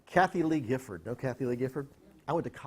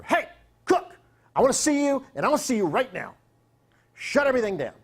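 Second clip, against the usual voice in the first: high, loud mock bark of a name; calling across the set, demanding; retold summons; 9 semitones higher, 6 dB louder than usual. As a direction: boss yelling for Cook mid-shoot. Boss calling him over shoulder.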